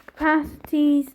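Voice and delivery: ordinary talk, nothing marked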